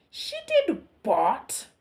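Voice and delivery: hammed-up shocked king. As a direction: gasp it out in horror. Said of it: "cried the king".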